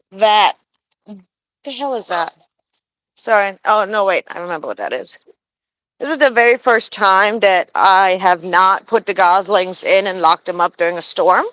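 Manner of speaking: normal talking voice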